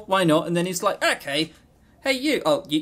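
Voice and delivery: usual speaking voice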